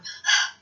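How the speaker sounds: sharp startled gasp. Retold or imitated. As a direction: gasp for air. Showing how she jumped up.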